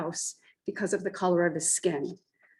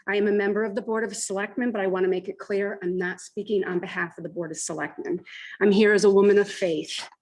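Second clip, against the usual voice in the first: usual speaking voice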